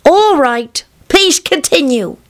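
put-on high childlike voice; small boy giving in, pitched high; little son. Son asking dad to continue, high child voice.

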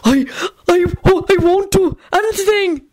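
high, slow panicked stammer; high-pitched stuttering despair; despairing squeal. A narrator's reading. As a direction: squeal it in helpless terror. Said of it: "Albert squealed in despair".

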